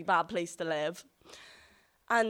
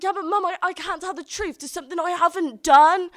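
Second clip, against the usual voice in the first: much higher and louder tearful protest; retold crying plea; 9 semitones higher, 12 dB louder than usual. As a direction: plead like a sobbing four-year-old. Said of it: "I'm crying at this point".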